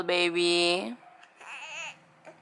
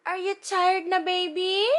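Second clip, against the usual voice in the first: normal talking voice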